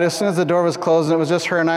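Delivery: ordinary talk, nothing marked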